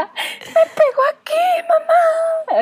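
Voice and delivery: high, slow, wailing tattle; super drama. Retold or imitated. Aunt mocking niece tattling to mom, melodramatic. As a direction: overact a crying princess. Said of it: "Así, en súper drama".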